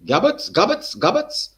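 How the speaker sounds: high, loud call across the yard. Retold or imitated. Eddie's father calls out to him, high loud call. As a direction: holler his name from a distance.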